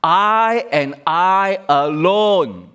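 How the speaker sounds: "I and I alone" very slow, loud and high; emphatic I and I alone. Shown as a demonstration. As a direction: drag out "I and I alone", every word hammered. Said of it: "the way it is phrased, "I" is emphatic".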